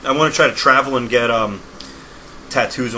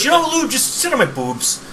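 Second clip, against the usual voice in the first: mock wife mishearing; 12 semitones higher than usual. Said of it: "it sounded like she goes".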